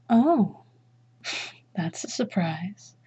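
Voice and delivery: ordinary delivery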